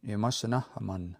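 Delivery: Imasanahaman with a short "uh"-like last vowel; short final A. Shown as a demonstration. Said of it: "make that short A sound".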